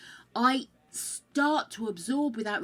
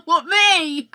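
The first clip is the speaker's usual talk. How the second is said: laughing disbelief, much louder; 8 semitones higher, 13 dB louder than usual. Real, not acted. Streamer laughing when a chatter teases her. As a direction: blurt it out through real laughter.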